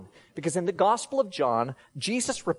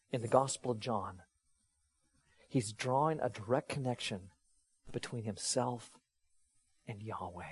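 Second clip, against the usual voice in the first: much softer and lower, with long pauses; hushed awe; 6 semitones lower, 8 dB quieter than usual. Real, not acted. Preacher tells congregation it gives chills, hushed awe. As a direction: drop to a reverent hush, phrase by phrase. Awed climax about God's name.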